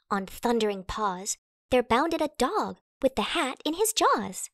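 ordinary delivery, nothing marked

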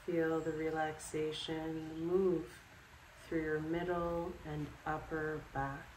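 calm, unhurried guided relaxation with long pauses; guided meditation. Acted. Yoga instructor guiding listeners to sleep in bed, calm meditation voice. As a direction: lead them through it step by step, leaving space after each instruction.

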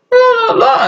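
high wailing mock cry. Brother bursting into tears in a family argument, mock crying. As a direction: cry the blahs in a squeaky wail. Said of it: "And then one starts crying".

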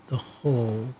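ordinary talk

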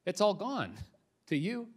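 normal talking voice

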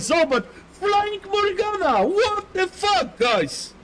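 high-pitched, drawn-out cry of disbelief; hyped amazement. Real, not acted. Streamer showing viewers a flying Morgana, high amazed cry.

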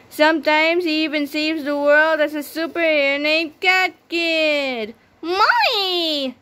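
put-on high, bright little-kid voice for the cast intro; childlike Li'l Petey voice. A narrator's reading. Narrator introducing Li'l Petey in a little kid's voice. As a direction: sound like an eager little boy.

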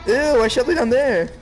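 loud, high frightened yelps; panicked. Real, not acted. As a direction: freak out at the monster. Scared by monster in game.

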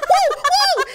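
high, loud alarmed cry; startled driver. Retold or imitated. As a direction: imitate a driver caught off guard. Driver startled by passenger's panic.

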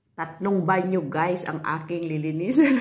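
loud, amused groan; excited exclamation. Real, not acted. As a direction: announce the chores with mock dread. Laughing at three bathrooms.

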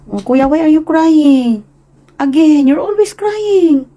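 high, loud, slow coaxing scold; high sing-song mother scolding. Real, not acted. Mother to her crying son, high sing-song scold. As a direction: coax him out of crying.

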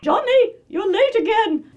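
very high, loud cartoon scolding; scolding cartoon character. Shown as a demonstration. Cartoon character scolds Johnny for lateness, scolding. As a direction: shriek it in a piercing cartoon voice.

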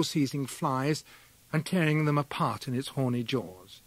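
plain reading voice

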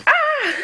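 very high, loud yelp; sudden high-pitched shriek; startled squeal. Real, not acted. Owner playing with the puppy, sudden high squeal.

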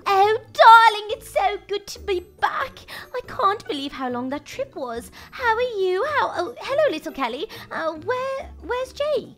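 genteel wife with a stretched, gushing greeting; doting wife. Acted. Wife greets her husband after a trip, doting. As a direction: play the mother just home from a trip.

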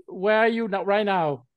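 normal talking voice

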